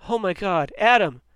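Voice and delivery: high, loud cry of alarm; shouted. A narrator's reading. Ricky sees the stretcher come out, shouting in alarm. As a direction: scream his brother's name in shock. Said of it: "he shouted".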